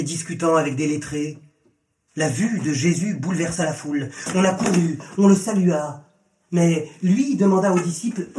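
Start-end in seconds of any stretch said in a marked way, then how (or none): none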